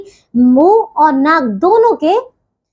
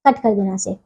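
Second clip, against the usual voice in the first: much lower, softer aside; off-script aside; 8 semitones lower, 6 dB quieter than usual. Real, not acted. Teacher asks editor to cut the take, low quiet aside. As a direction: drop your voice for a note to the editor.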